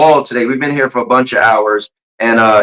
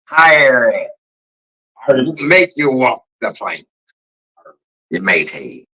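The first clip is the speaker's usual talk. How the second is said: put-on pirate voice, drawn out; mock pirate. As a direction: growl it like a hammy pirate. Pirate impression among accent attempts.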